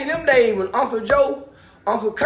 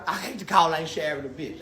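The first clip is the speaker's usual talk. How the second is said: ordinary talk, nothing marked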